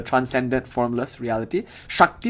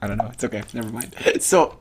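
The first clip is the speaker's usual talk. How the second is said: normal talking voice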